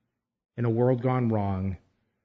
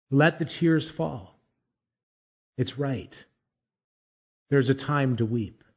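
ordinary talk, nothing marked

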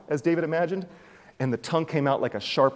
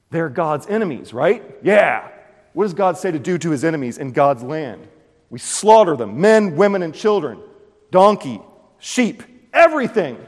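zealous fanatic; 4 semitones higher, 7 dB louder than usual. Retold or imitated. Doeg justifying massacre.

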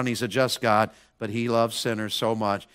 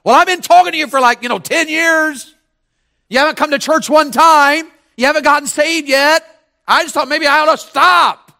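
retold loud plea; 11 dB louder than usual. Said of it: "He said".

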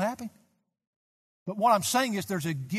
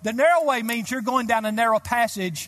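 loud, high pulpit shout, fast in places; fervent preacher exhorting the crowd; shouted preaching; 5 semitones higher, 5 dB louder than usual. Acted. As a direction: call out the command with fire. Preaching at full force.

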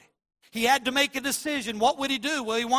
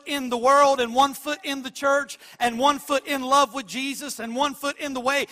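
louder, driving preacher's cadence; preaching at full force; 2 semitones higher, 6 dB louder than usual. Acted. Preacher warns church against neutrality, preaching at full force. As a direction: pound the point home to the church.

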